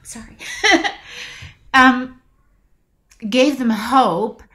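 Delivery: apologetic laugh through welling emotion; choked up. Real, not acted. Actress apologises to interviewer for getting emotional, choked up. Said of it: "I'm getting choked up".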